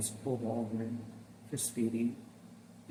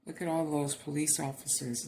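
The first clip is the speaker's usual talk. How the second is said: ordinary talk, nothing marked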